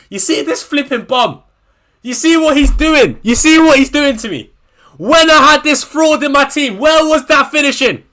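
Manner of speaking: loud furious ranting; really fed up and yelling; angry rant. Real, not acted. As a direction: shout your grievance at the player. Ranting at his fantasy team player.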